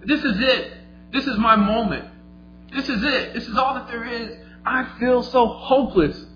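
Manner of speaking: high, urgent inner plea; desperate. Acted. Leper's desperate inner monologue, acted.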